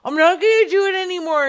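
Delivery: high, loud, rapid refusal; mock defiance. Refusing the caller, loud mock defiance.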